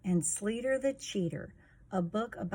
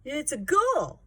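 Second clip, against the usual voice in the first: higher, louder stubborn claim; insistent shout; 7 semitones higher, 6 dB louder than usual. Acted. Sleater claiming a missed shot counts, higher and louder. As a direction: insist on the goal though you missed. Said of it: "Sleater growled".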